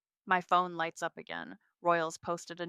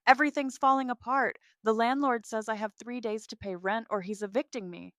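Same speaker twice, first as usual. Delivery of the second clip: high, louder tearful rush, fast at first; sobbing; 4 semitones higher, 7 dB louder than usual. Acted. Sister collapsed on the couch begging, tearful and fast. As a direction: wail it through tears. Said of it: "She collapses onto my couch, sobbing".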